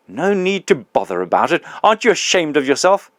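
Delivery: put-on the big ghost confronting his old employee, loud and aggrieved; indignant big ghost. Ghost outraged.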